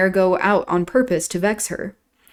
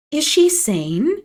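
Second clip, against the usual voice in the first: raised, slow, disbelieving; incredulous aside; 8 semitones higher, slower than usual. A narrator's reading. Catherine appealing to Nelly about Isabella, incredulous. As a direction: ask it slowly as if she can't believe it. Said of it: "asked Missus Linton, appealing to me".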